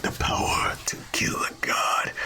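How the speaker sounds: whispered, ominous narration; hushed epic whisper. Retold or imitated. Narrating the box's power, hushed whisper. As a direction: breathe it like a movie trailer.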